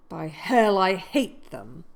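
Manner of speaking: a sudden loud oath, the last words slowed right down. A narrator's reading. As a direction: spit out a furious curse. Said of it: "swore to himself".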